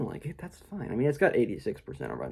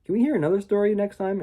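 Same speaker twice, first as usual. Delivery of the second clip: put-on higher, pleading child voice; eager kids; 9 semitones higher, faster than usual. The kids begging grandma for another story, higher and eager. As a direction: ask it like a hopeful child. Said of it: "the kids are like".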